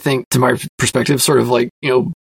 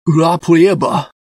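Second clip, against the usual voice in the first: hammed-up bad Russian accent; a quick, jokey stab at a Russian accent; terrible Russian accent; 4 semitones higher, 3 dB louder, slower than usual. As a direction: toss off the Russian title in a clumsy Russian accent. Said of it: "in a terrible Russian accent".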